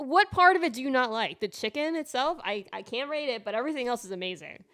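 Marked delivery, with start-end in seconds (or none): 0.1-2.4 loud, high-pitched demand for an explanation; incredulous challenge. Real, not acted. Questioning friend who dislikes Chick-fil-A, incredulous challenge. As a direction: press them in disbelief, voice raised.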